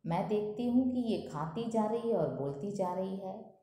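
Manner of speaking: ordinary talk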